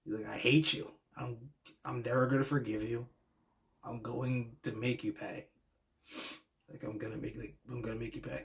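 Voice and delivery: retelling a promo softly, close to tears; imitating a choked-up plea, quiet and hurt; a hint of an upset friend on the verge of tears; near tears. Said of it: "he almost sounded like he was on the verge of tears".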